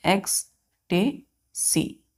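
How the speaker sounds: Ecstasy very slowly in three pieces, "ex, ta, sy"; syllable by syllable. Shown as a demonstration. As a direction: break ecstasy up with a pause after each syllable. Teacher sounds word out by syllable.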